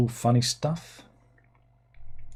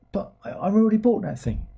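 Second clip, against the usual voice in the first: high-pitched buyer's objection; mock protest; 10 semitones higher than usual. Buyer objects to being sold it twice, mock protest. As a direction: object in a higher, put-on voice.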